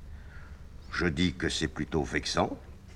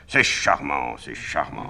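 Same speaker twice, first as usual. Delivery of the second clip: put-on old star; 7 dB louder, slower than usual. A narrator's reading. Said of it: "très vieille vedette".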